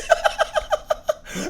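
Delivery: very high disbelieving laugh; host cracking up at the twist; stunned laugh. Real, not acted. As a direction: burst into shocked laughter.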